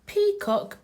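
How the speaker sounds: plain reading voice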